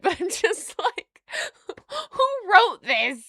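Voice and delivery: high, slow, laughing outcry; real laughing incredulity; laughing disbelief. Real, not acted. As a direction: laugh while demanding who wrote it.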